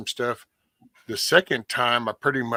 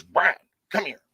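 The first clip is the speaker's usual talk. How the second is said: stern mom; 5 semitones higher than usual. Retold or imitated. Mom voiced in story.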